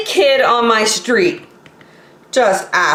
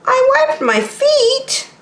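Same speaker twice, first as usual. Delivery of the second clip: high, slow plaintive whine; hurt protest; slower than usual. Acted. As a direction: plead that it's unfair.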